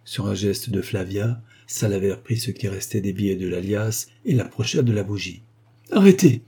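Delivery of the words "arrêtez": high, loud scream to stop; desperate cry. Acted. The ex-valet begs them to stop burning, loud cry. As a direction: shriek as the money burns. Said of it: "s'écria l'ex-valet du baron".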